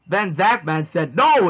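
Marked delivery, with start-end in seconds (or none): 1.1-1.5 high, loud "No!"; distant cry of no. A narrator's reading. Zackman reacts to being grounded, crying out high. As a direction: cry it out as if calling from afar. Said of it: "in the distance".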